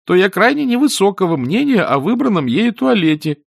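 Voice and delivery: high, loud, petulant complaint; complaining youngster. A narrator's reading. Boy grumbles to the wizard about the lady's taste, petulant. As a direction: whine indignantly in a high boy's voice. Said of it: "пожаловался Эрик".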